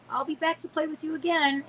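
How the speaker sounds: high, singsong imitated female voice; female visitor's voice. Visitor promises child a return, high female voice. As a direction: lift it high and even, like an otherworldly playmate. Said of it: "I heard a female voice".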